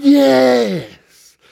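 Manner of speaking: high, drawn-out cheer; crowd's shout. Acted. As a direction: answer like an excited crowd. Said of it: "And the crowd shouted".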